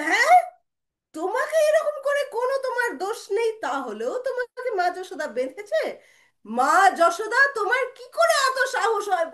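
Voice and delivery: high, loud, mounting indignation; outraged yelling. Acted. Brother challenging mother Yashoda, loud angry shouting. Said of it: "বলে একদম জোরে জোরে চিল্লাচ্ছে".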